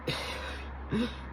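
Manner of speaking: soft airy sigh; breathy sigh. Real, not acted. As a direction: exhale heavily. Sighing before topic.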